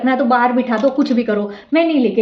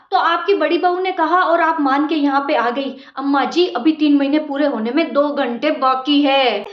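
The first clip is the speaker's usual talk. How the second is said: normal talking voice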